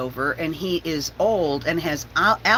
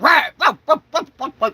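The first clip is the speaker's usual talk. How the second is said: loud barking "rah rah" spoken like a dog; dog grumbling at the man in mock barks; 7 semitones higher, 5 dB louder, faster than usual. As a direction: bark it out in a put-on dog voice. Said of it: "but talked to him like".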